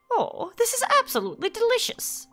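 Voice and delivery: a gentle grandmotherly figure, lightly played; voicing Alice. Reading Alice's dialogue aloud.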